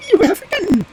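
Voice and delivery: very high squeaky bird voice; high, loud little bird; wood pigeon answering. Acted. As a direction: pipe it up as a tiny pigeon.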